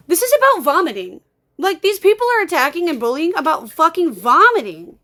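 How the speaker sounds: real outburst, loud and high; exasperated, pitch leaping as you protest loudly; pissed off. Real, not acted. Streamer protesting bullying over a vomiting phobia, pissed off. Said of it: "obviously pissed off".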